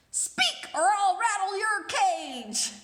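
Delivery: high, loud screechy parrot threat; screaming parrot. Acted. The parrot screams a threat at the frightened prince. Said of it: "the parrot screamed".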